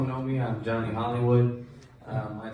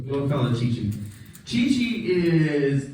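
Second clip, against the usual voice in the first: usual speaking voice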